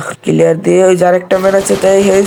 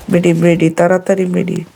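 normal talking voice

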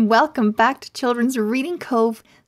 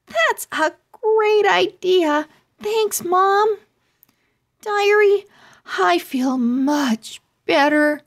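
cheerful Eva; 8 semitones higher, 3 dB louder, slower than usual. Acted.

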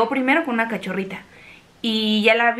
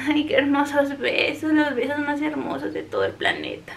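tender; 3 semitones higher, 4 dB quieter than usual. Real, not acted. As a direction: coo lovingly to the dog. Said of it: "un momento de besos".

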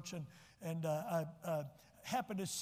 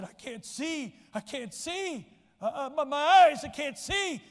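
alarmed; 7 semitones higher, 10 dB louder, slower than usual. Retold or imitated. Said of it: "He said".

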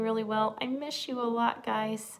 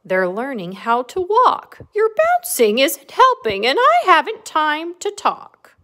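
loud, high storybook complaint; animated scolding, lightly acted; grumbling cow; 7 semitones higher, 14 dB louder than usual. A narrator's reading. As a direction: fuss at the bunny as a busy mother cow. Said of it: "grumbled Cow".

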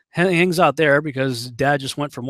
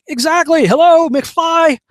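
very high, loud sarcastic taunt; loud, drawn-out gotcha in a high voice; mocking shout; 13 semitones higher, 7 dB louder, slower than usual. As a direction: call it out like knocking on a dimwit's head. Son mocks dad's admission, movie catchphrase.

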